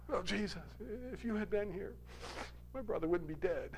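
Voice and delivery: poor imitation of weeping Mary. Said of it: "That was a poor imitation of Mary".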